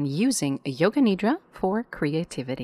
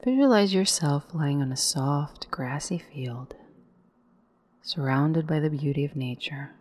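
guided meditation. Acted. Guide leading listeners into yoga nidra, calm meditation voice. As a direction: lead the breath in on a rise, let the exhale fall away.

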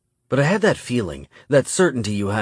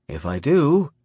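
plain reading voice

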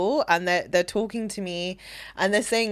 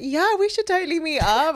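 fake polite; 9 semitones higher than usual. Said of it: "politely being like".

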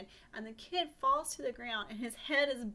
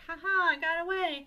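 high, loud sing-out of triumph; gleeful taunt; 8 semitones higher, 9 dB louder than usual. Acted. Pinocchio escapes the police dog, crowing loudly in mock triumph. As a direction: crow over a clean getaway.

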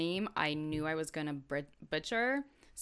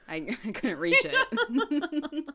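laughing; 7 semitones higher, 3 dB louder, slower than usual. Real, not acted. Laughing defence.

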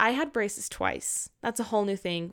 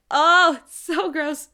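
loud, high grossed-out cry; disgusted; 5 semitones higher, 7 dB louder, slower than usual. Real, not acted. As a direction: recoil in disgust. Disgust at memory.

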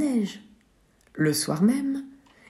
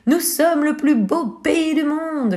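much higher, proud announcement; triumphant call; 9 semitones higher, 4 dB louder than usual. Acted. Bako returns to his village, calling out triumphantly. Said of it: "lança-t-il en arrivant".

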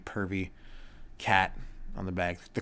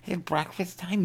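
higher cat begging voice; 10 semitones higher than usual. Retold or imitated. Cat begging the owner at dawn, higher. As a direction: beg for food as the cat. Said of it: "Wakes me up".

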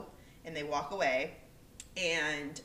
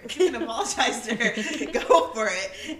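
laughing; 3 semitones higher, 9 dB louder than usual. Real, not acted. Laughing through her retold reply.

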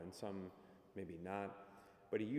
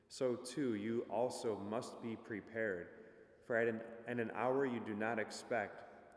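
formal liturgical reading, projected; gospel proclamation; 2 semitones higher, 4 dB louder than usual. Acted. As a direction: proclaim it like a priest at mass.

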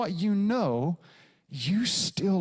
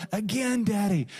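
put-on excited toddler plea; 4 semitones higher than usual. Toddler begging dad.

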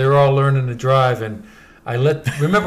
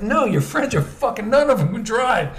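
raised-pitch retold objection; indignant refusal; 7 semitones higher than usual. Car owner refusing to let friends drive, indignant.

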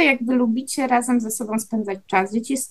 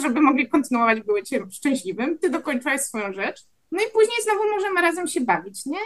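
ordinary talk

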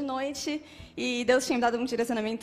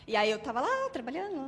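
ordinary delivery

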